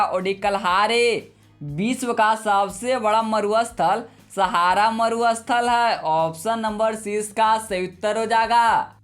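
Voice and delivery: ordinary talk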